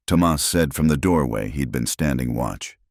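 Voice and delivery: ordinary delivery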